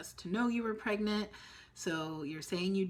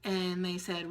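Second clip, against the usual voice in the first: ordinary delivery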